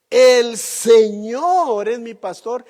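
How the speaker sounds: "El Señor es mi pastor" boomed out slowly and loud; loud, slow scripture proclamation. Shown as a demonstration. Preacher to congregation, quoting the psalm, loud and slow. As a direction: proclaim the verse slowly to the whole hall. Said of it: "cuando dice".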